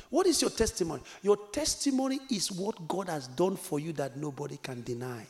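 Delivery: usual speaking voice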